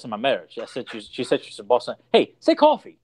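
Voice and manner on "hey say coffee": much higher, teasing prompt; mock-eager, pitched way up; pestering request. Retells pestering her for accent.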